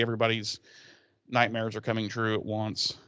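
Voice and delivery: ordinary talk, nothing marked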